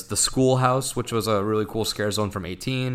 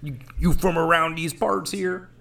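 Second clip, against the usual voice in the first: put-on cowboy accent; 8 semitones higher than usual. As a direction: play an old-West cowboy stranger. Cowboy talk after western idea.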